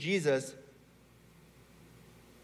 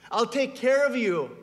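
higher, louder, declaring voice; emphatic promise; 6 semitones higher, 5 dB louder than usual. Acted. God promising to care for the doubter, emphatic. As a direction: proclaim the promise with force.

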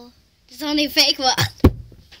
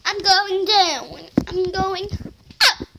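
slow dramatic; 6 semitones higher, 8 dB louder than usual. Real, not acted. Slow dramatic ride narration.

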